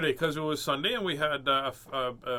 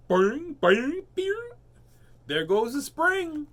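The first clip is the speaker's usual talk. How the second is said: high springy boings, then a drawn-out cry; high, slow, loud cartoon rabbit; storybook rabbit; 12 semitones higher, 7 dB louder, slower than usual. A narrator's reading. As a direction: exclaim as a rabbit over a broken spring.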